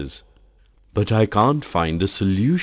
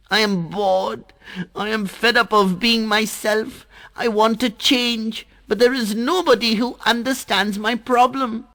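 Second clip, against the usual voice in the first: put-on high, sweet child voice, flattering; butter up the Sun in a high bright voice; little water drop sweet-talking the Sun, voiced high; Jal the water drop.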